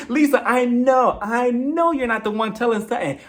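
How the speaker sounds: high, drawn-out mock protest on I KNOW; theatrical, slow, high-pitched retort; hammed-up exasperation. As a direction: stretch the words out, high and over-dramatic. Restarts acted retort after cracking up.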